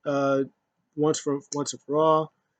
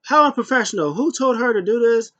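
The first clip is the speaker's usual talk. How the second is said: high, loud indignant huff; mock hissy fit; 9 semitones higher, 7 dB louder than usual. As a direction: shriek it like a spoiled diva. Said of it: "Sasha's having hissy fits".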